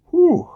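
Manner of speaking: real high, loud "whew"; short high falling whoop of reaction. Real, not acted. Drinker reacts to a dangerous-looking pour, high loud whew.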